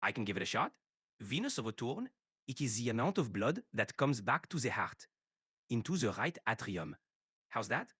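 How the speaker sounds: French Cypriot accent, mostly French-sounding English. Shown as a demonstration. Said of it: "Can you do a French".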